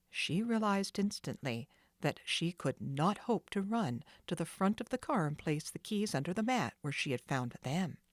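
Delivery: plain reading voice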